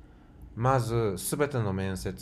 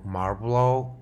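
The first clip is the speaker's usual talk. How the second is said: put-on "Marlboro" said as Japanese English with a heavy accent, ma-ru-bo-ro; 2 semitones higher, 5 dB louder, much slower than usual. Traveler pointing at cigarettes for the cashier, with a heavy Japanese-English accent. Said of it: "con acento de inglés muy marcado hablado por un japonés".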